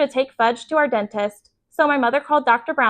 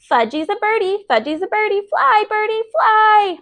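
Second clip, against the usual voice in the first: put-on excited toddler; 10 semitones higher than usual. A narrator's reading. Toddler excitedly pretending to fly.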